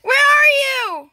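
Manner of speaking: high, loud searching call; worried high-pitched holler. Pretend. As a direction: call out for a lost sister. Calling for missing sister.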